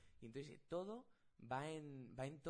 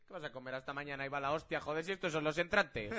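quoted film reply; 3 semitones higher, 13 dB louder than usual. Retold or imitated. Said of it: "Y dice".

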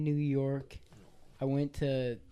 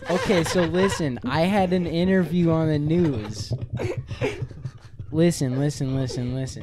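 excited; 4 semitones higher, 7 dB louder than usual. Real, not acted. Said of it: "we need a backstory".

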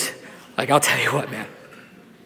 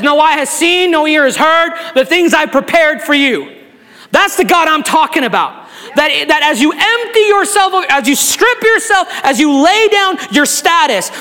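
rising, louder preacher's cadence, slowing on the big words; preaching at full force; 10 semitones higher, 4 dB louder than usual. Acted. Pastor pressing the congregation to lay pride down, preaching hard. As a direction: build the sermon up and drive each phrase home.

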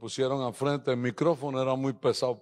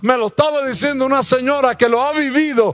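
louder, higher, fiery sermon; full-force preaching; 9 semitones higher, 10 dB louder than usual. Acted. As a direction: preach it out to the whole church. (predicación a todo pulmón, más fuerte y más aguda)

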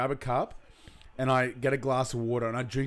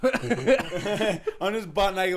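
very slow, high, laughing it out; 6 semitones higher, much slower than usual. Real, not acted. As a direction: wheeze the words through a laugh. Laughing while retelling.

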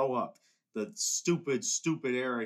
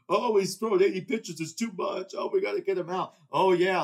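higher, louder whiny mock voice; mocking the front office; 4 semitones higher, 4 dB louder than usual. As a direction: parody the cautious managers, sneering.

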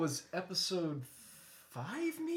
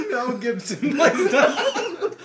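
slow, louder, laughing through the words; 6 semitones higher, 7 dB louder, slower than usual. Real, not acted. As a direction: drag it out while cracking up. Laughing correction.

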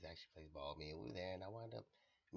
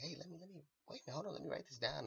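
excited friend; 10 semitones higher, 4 dB louder, faster than usual. Retold or imitated. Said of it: "one of them was actually like".

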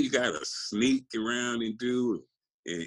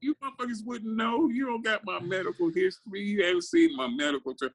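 higher, half-laughing outraged comeback; heated retort. Retold or imitated. Lifter tells doubters they don't know his medical history, in a higher, half-laughing outraged voice. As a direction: fire back at the know-it-alls, laughing in disbelief.

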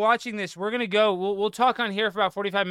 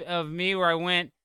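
ordinary talk

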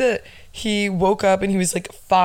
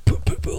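mic pops; faster than usual. Shown as a demonstration. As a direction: pop your lips like plosives hitting a bare mic. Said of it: "every time I said pee".

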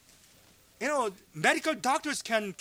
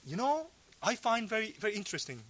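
ordinary delivery

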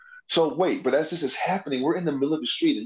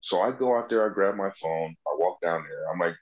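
ordinary talk

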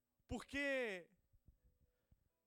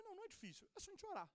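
much higher, light and softer; pitched-up carefree retort; breezy dismissal; 7 semitones higher, 6 dB quieter, faster than usual. Retold or imitated. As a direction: wave the worry away cheerfully. Brushing off mother's worry.

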